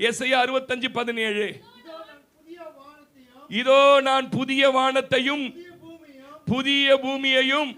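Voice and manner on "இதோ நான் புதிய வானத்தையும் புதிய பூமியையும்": loud, slow scripture proclamation; proclaimed scripture. Acted. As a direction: declare God's promise slowly and loudly. Reading God's promise aloud. (உரத்த, மெதுவான வேத அறிவிப்பு)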